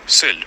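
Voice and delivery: сіль with Ö in place of i; сіль, vowel shifted from i toward Ö; village Ö vowel. Shown as a demonstration. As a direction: say сіль with a rounded Ö sound.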